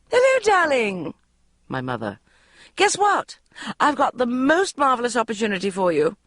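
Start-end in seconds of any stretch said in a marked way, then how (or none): 0.1-1.1 high, loud, sing-song greeting; gushing mother. A narrator's reading. Mother phoning Bridget with big news, high gushing trill. 2.8-6.2 high, loud, sing-song greeting; gushing mother. A narrator's reading. Mother phoning Bridget with big news, high gushing trill.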